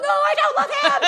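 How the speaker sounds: put-on high, quick child's voice; defiant toddler. Mom imitating her son refusing, high and quick. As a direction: snap back like a stubborn little boy. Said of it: "son refusing".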